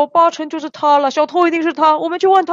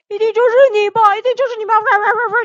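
high, insistent accusation; accusing; 7 semitones higher, much faster than usual. Acted. Accusing the fox pharmacist of theft, higher and insistent. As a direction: point the finger and press hard.

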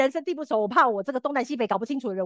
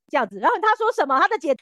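normal talking voice

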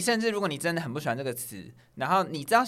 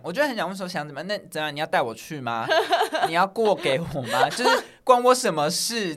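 sarcastic retort; 2 semitones higher, 6 dB louder than usual. Pretend. Mock retort to date's bragging.

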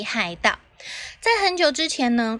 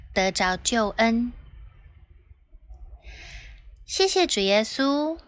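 prayer. Acted. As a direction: pray it gently, pausing between phrases. Leads children in prayer.

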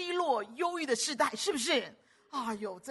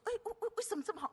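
quick, high astonished why on 为; mock amazed question; 12 semitones higher, 5 dB quieter, faster than usual. Preacher voicing a listener's question: quick, high amazed asking.

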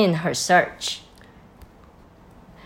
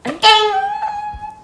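put-on high giggle in a girl's voice; squeaky falsetto laugh. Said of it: "Another little girl sound".

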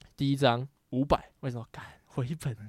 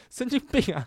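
high, loud, laughing disbelief; half laugh, half yell at the madness; laughing outburst; 10 semitones higher, 5 dB louder than usual. Retold or imitated. Retelling his disbelief at the friend, laughing yell.

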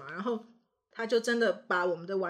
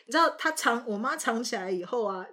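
ordinary talk, nothing marked

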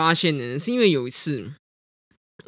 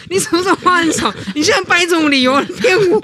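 higher and louder, accusing; mock indignant retort; 10 semitones higher, 6 dB louder than usual. Girlfriend accusing him of inventing excuses, mock indignant. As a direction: call out the lie with mock outrage.

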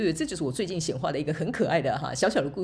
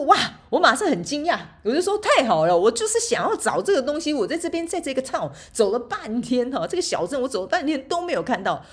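a higher, louder, thrilled exclamation; excited; 7 semitones higher, 5 dB louder than usual. Retold or imitated. Retelling finding the ice cream, higher and louder with excitement. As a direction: relive the joy of finding it. 我马上很惊讶.

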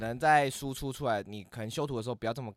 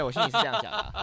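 laughing admission; 10 semitones higher, 7 dB louder, faster than usual. Real, not acted. Admits rude inner thought.

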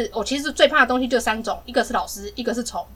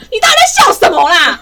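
loud, higher yell at her little sister; re-enacting yelling at her sister in the bath; angry yell; 7 semitones higher, 9 dB louder than usual. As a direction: demand an answer, fed up and shouting. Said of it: "我很生气".

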